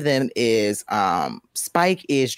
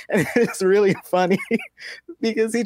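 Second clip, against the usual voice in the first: giggling through the words; laughing as you tell it; 10 semitones higher than usual. Real, not acted. Laughing while retelling.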